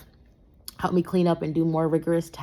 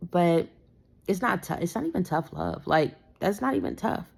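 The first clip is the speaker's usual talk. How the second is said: usual speaking voice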